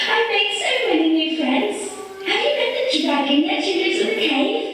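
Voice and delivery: high, quick chatty cat voice; high-pitched cat rushing through news; excited cat. Acted.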